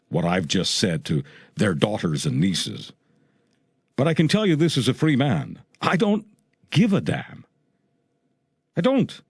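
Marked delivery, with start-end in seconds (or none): 5.8-9.2 high, emphatic, broken by pauses; real defiance. Real, not acted. Radio host tells listeners he answers to no one, defiant. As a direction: declare it defiantly, pitch jumping up. Defiant declaration as a free man.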